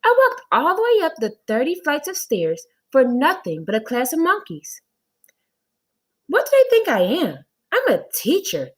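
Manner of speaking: high, loud exasperated complaint; indignant teacher. Acted. Teacher fuming about a class of monkeys, high and loud. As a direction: huff about the climb for nothing. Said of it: "said Miss Jules".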